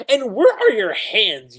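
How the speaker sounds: loud, high indignant snap; mock outrage. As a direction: scold him, hammed-up. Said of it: "She's like".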